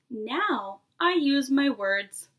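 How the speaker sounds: each word spelled out slowly; careful, drawn-out little-kid pride; slow and deliberate. Acted. Child voice proudly stating growth.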